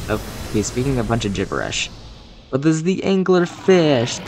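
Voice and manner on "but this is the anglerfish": high, drawn-out boss announcement; dramatic announcer. Acted. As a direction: build it up like a showman.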